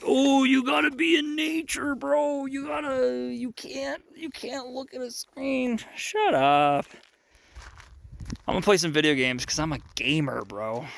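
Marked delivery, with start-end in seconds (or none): 0.0-5.8 high, whiny mock lecture; mocking critic voice. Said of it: "Some of you might hate on that". 6.0-6.9 loud joking shut-down; playful dismissive. Real, not acted. Answering his own mock critic.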